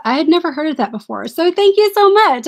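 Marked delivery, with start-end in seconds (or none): 1.3-2.5 higher and louder, falling on much; gushing thanks. Real, not acted. Gushing thanks to Carol for the brooch she sent. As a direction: burst out with thanks.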